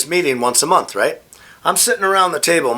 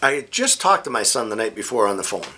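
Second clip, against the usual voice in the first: ordinary talk, nothing marked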